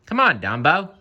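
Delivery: bright, high, eager urging; eager call. Acted. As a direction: coax him along with a grin. Timothy urging Dumbo.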